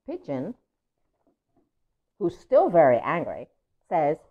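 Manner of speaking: ordinary delivery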